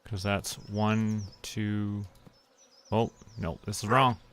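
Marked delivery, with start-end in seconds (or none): none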